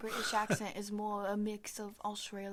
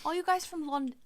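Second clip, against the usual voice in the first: put-on British accent; 5 semitones higher, 6 dB louder than usual. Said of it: "speak in a British accent for you".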